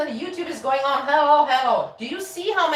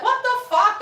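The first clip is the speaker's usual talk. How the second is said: higher, shocked exclamation; indignant outburst; 9 semitones higher than usual. Retold or imitated. Retelling her reaction to the rude chat joke, shocked. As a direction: react in mock outrage.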